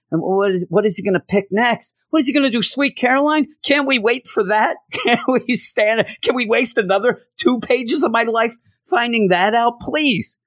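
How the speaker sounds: high-pitched, pleading exasperation; exasperated rant. Real, not acted. Reviewer fed up with wasted pages, exasperated. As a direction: complain as if begging to be spared.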